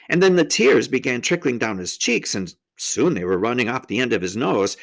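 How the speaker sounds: ordinary delivery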